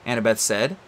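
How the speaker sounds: ordinary delivery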